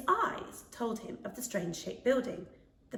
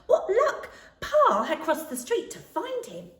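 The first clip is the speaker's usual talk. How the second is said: delighted excitement; 9 semitones higher, 7 dB louder than usual. A narrator's reading. Max thinks Pa found him.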